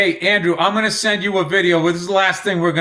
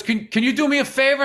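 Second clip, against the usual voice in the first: higher-pitched imitation of a kid asking; mock polite kid; 7 semitones higher than usual. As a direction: lightly act out a polite, hesitant request. Retells kid asking a stranger.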